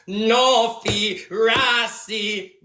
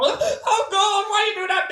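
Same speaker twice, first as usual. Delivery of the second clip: higher hammed-up crying wail; mock crying; 11 semitones higher than usual. Woman cries out after Beanie hits her, hammed-up higher wail. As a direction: cry it out as a mocked woman. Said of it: "She said".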